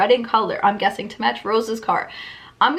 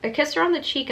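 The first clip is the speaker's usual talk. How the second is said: ordinary talk, nothing marked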